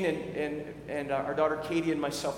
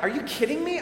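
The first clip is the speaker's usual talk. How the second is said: exasperated disbelief; 8 semitones higher, faster than usual. Retold or imitated. Pastor's inner reaction to intruder.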